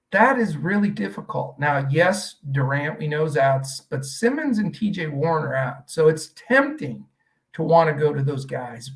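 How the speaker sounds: usual speaking voice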